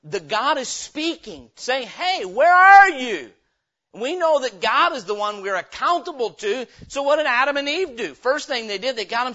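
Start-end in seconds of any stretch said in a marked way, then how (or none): 1.9-3.3 loud, high, slow call into the garden; God calling out. Acted. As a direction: call out loudly, searching for someone hiding.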